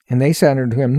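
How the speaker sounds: ordinary delivery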